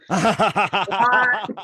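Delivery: stunned disbelief breaking into a laugh; retold surprise. Learning [name] from Cheap Trick is behind her, a laughing surprised What. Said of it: "I'm like".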